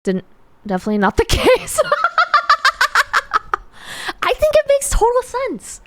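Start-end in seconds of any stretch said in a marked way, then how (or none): 1.8-3.6 high squeaky laugh like a dolphin. Real, not acted. Streamer laughs at chat like a dolphin. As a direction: let a shrill dolphin laugh fly. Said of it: "Do I sound like a dolphin?".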